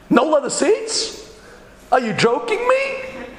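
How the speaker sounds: very high, drawn-out disbelieving question; squeal-pitched indignant disbelief, stretched out; mock incredulity. Partner incredulous about the car.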